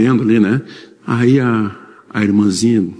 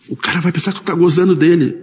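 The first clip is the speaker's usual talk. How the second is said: half-laughing, pitched up; laughing warning; 7 semitones higher than usual. Retold or imitated. Girlfriend Camila warning him to drop the cutlery, laughing. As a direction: chuckle through the warning.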